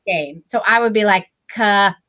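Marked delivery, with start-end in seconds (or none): 1.5-2.0 flat, even robot monotone. Retold or imitated. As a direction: say it like a toy robot. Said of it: "I would use my robot voice".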